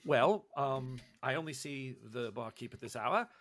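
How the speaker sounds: low, soft, halting gentleman scholar; hesitant professor, politely deferring. Acted. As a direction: stammer apologetically, keeping it low and soft. Professor answers in character.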